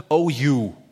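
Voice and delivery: a light mock rebuke, loud; rebuking Jesus. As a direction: scold them: oh you. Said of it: "He usually started with".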